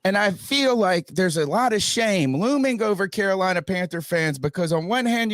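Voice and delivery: ordinary delivery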